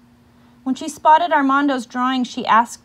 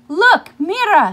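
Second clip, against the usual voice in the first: excited; 9 semitones higher, 9 dB louder than usual. Acted. Teacher shows newspaper excitedly.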